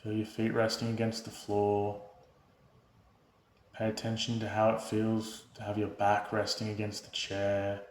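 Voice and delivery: soft, unhurried meditation voice with long pauses; guided meditation. Real, not acted. Host leading viewers through breath and body awareness, calm guided meditation. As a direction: guide them gently into stillness, hushed and even.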